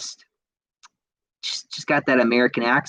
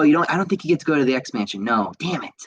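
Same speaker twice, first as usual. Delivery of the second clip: usual speaking voice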